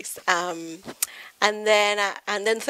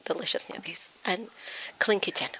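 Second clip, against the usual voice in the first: hushed punchline; 7 dB quieter than usual. Real, not acted.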